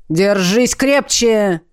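slow, gruff dragon growl; growled command. A narrator's reading. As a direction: growl it out, holding on tight. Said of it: "рыкнул Леонард".

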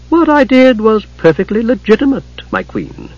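high, slick self-justifying voice, dropping on the address; smooth-talking schemer; oily smooth. A narrator's reading. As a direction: plead smoothly to a queen. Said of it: "oiled and smooth".

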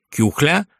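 ordinary delivery

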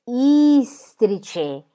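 "istrice" with a long, stretched opening i; teacher sounding out "istrice", lingering on the first i; drawn-out first letter. Acted. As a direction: stretch the initial vowel of "istrice". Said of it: "questa lettera al principio del istrice".

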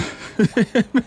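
high, short, wry laugh; a bitter laugh at himself; high wry chuckle. Acted.

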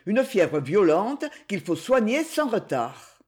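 plain reading voice

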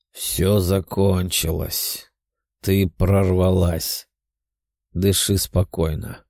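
slow, low soothing voice; calm her gently and slowly. A narrator's reading. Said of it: "Тео говорил успокаивающим голосом".

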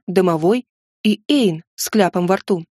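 ordinary delivery

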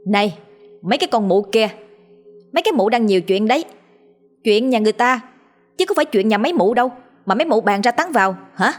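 hands on hips, rapid-fire abuse at the gossips; shrewish scolding. Acted. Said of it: "sắn mỏ lên chửi". (chửi nhanh, chua ngoa, cao giọng)

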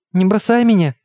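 high, pleading cry without full volume; child crying out. A narrator's reading. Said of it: "крикнула я". (умоляющий детский выкрик)

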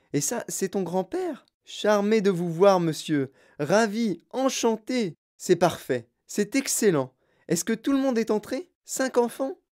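high, excitable host voice; Wonka effusive. Acted. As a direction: gush greetings, then marshal the group.

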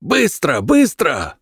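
high urgent ragged shouting; hoarse yell. Acted. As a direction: drive the riders back, hoarse. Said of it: "хрипло заорал он".

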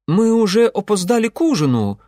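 high, loud worried reminder; nervous young man pipes up; anxious young man. Acted. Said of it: "рискнул напомнить Ингвар".

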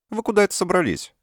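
high, loud suspicious demand. Acted. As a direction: squint and call them out. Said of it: "Подозрительно сощурившись, напустилась".

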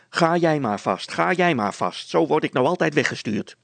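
high, loud mocking repeat; peevish. A narrator's reading. Wife echoes her husband's dismissal, peevishly. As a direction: throw the words back crossly. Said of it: "zei vrouw Brilstra korzelig".

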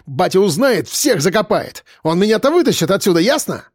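put-on high, heated young rich-kid threat; breaking into a shout. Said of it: "Вдруг сорвался он на крик".

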